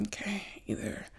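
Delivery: breathy, near-voiceless resignation; resigned whisper. Retold or imitated. Walking to the manager's office, whispering resigned to herself. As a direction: murmur it under your breath, resigned. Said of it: "I was like".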